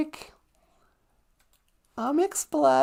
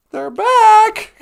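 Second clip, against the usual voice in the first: higher, much louder cry; acted excited announcement; 8 semitones higher, 11 dB louder than usual. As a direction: proclaim the big return. Acting out the toys' return.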